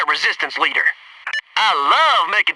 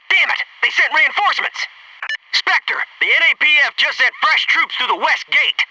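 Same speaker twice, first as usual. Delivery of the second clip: urgent alarm; 7 semitones higher than usual. Acted. Reporting enemy reinforcements.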